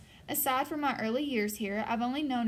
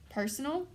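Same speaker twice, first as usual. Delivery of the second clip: "Personal" lifted into an unsure question; tentative, questioning rise on "Personal"; 3 semitones higher than usual. A narrator's reading. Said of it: "My mouth says it like a question".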